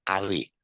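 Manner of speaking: Harry with a silent H, as 'Arry; Cockney-style Harry without the H; dropped H. Shown as a demonstration. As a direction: start Harry straight on the vowel, no H. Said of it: "it's definitely someone that would drop the H's".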